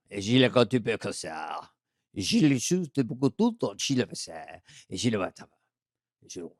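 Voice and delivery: mock French gibberish; French man. Host pretending to be a Frenchman in an earthquake, mock French. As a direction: fake French babble. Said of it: "I'm gonna do a French man".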